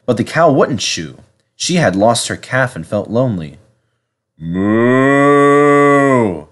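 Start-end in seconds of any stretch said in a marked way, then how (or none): 4.4-6.5 long, higher mournful moo; sad cow. A narrator's reading. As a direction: moo like a lonely mother cow. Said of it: "she said sadly".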